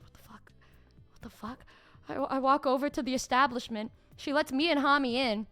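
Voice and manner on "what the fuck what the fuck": breathless whispered disbelief; shocked whisper. Retold or imitated. Stunned friend whispering to herself outside the shop. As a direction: hiss it under your breath.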